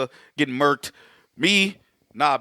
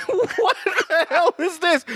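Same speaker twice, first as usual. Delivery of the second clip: high, slow, laughing through the words; laughing disbelief; much slower than usual. Retold or imitated. Retelling his reaction to the film, laughing high and slow. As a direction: crack up in disbelief. Retelling shock while watching.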